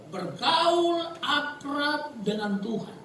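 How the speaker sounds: high, drawn-out proclamation; slow, lifted pulpit declaration; emphatic preaching. Real, not acted. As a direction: draw the key phrase out slowly. Preacher proclaims key phrase.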